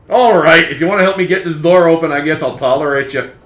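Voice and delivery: high, loud, irritable character voice; grudging coffin creature. Acted. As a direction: give in loudly as a cranky construct. Coffin grudgingly accepts help.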